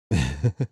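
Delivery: low, brief chuckle; short chuckle. Real, not acted. Announcer amused by the odd name, short chuckle. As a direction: let out a small low laugh.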